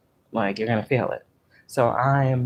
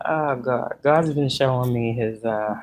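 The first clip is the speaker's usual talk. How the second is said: ordinary talk, nothing marked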